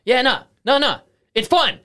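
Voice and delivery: high, loud, squeaky protest; mock defensive. On the phone to his partner, high loud mock denial. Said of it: "and you're just like".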